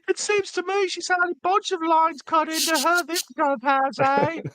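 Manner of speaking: very high, put-on British lady; British woman voice. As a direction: squeak it out like a posh old lady. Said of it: "my British woman".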